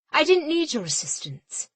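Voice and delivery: captain rebuffing her mate's help; angry retort. A narrator's reading. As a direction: spit it back.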